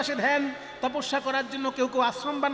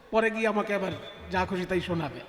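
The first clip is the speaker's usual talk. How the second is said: ordinary talk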